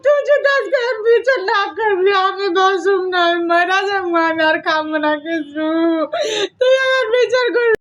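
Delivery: slow, sobbing mock complaint; overdone tearful guilt-trip; fake crying. Mother-in-law sobs to her son for justice, hammed-up. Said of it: "কাঁদতেছো কে?".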